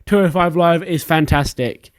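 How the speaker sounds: normal talking voice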